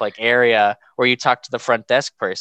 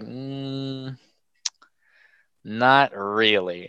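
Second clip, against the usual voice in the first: slow, drawn-out doctor shrug; a lightly voiced doctor letting him down slowly; unhelpful doctor; much slower than usual. Doctor tells the patient they can't know, slowly.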